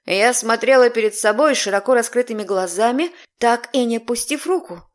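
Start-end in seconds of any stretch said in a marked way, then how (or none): none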